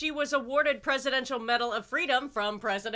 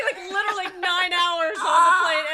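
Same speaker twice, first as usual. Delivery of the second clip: louder, half laughing, half crying; laughing tearfully; 3 semitones higher, 4 dB louder than usual. Retold or imitated. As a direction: laugh through remembered tears. Retelling crying at customs.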